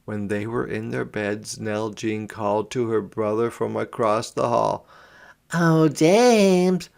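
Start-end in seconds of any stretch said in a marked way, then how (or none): 5.5-6.9 put-on high, sweet calling little girl voice; coaxing girl. A narrator's reading. Girl calls to her brother across the hall coaxingly. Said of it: "she said coaxingly".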